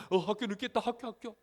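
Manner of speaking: high-pitched mock panic; flustered, repetitive scrambling; acted frantic fluster. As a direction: blurt it like a child waking up late. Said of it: "정신이 없어요".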